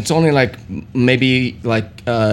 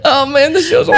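laughing; 8 semitones higher than usual. Real, not acted. Laughing at the end.

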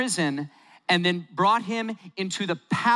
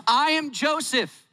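higher, slow and weighty; dramatic reveal; 8 semitones higher, slower than usual. Acted. Joseph revealing himself to his brothers, high and slow. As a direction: land the reveal slowly.

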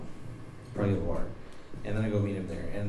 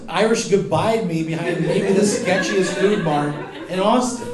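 loud, high-pitched comic protest; mock indignation; 8 semitones higher, 6 dB louder than usual. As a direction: protest with incredulous, laughing outrage. Player protests the vanished stranger.

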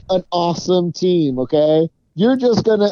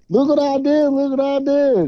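mocking boastful coach; 8 semitones higher than usual. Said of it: "and then Gurley comes in".

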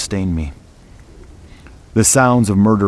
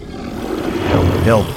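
rasping unvoiced beast whisper; hoarse creature whisper; growled whisper. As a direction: breathe it out like a wounded wolf. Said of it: "it growled again".

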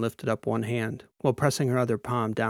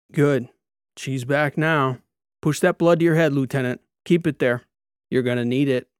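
loud drill instructor's commands; firm, urgent encouragement; forceful coaching; 3 semitones higher, 7 dB louder than usual. A narrator's reading. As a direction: drive the trainee on, phrase by phrase. Instructor coaching trainee through drop.

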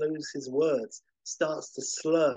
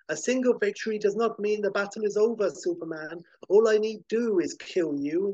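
gloating supervillain; 7 semitones higher than usual. Acted. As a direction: boast like a mad genius who thinks he's won. GM voices villain Ultra Humanite.

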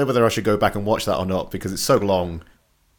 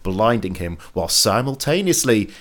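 lightly dramatic TV recap read; recap narration; 4 semitones higher, 5 dB louder than usual. Acted.

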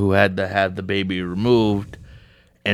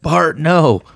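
higher, louder alarmed call; mock startled yell; 8 semitones higher, 4 dB louder than usual. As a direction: blurt it out like a parent's reflex. Sorry, it's a reflex.

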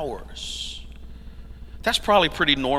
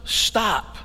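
ordinary talk

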